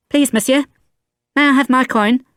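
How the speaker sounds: put-on high small boy's voice pleading; child's plea. A narrator's reading. Boy asking the stranger for his coin, pleading. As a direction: beg like a little boy.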